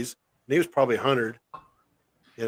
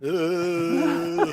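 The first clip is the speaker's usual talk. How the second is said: dude impersonation; 5 dB quieter than usual. Retold or imitated. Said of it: "try and impersonate the dude".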